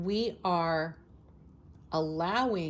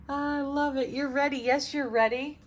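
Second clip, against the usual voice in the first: high, excited affirmation; fired up; 8 semitones higher, 4 dB louder than usual. Real, not acted. Tarot reader affirming viewers are ready, fired up. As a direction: cheer them on, high and fired up. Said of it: "I'm feeling all fired up now".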